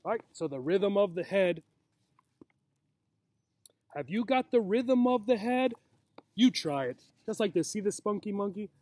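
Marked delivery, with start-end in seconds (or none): none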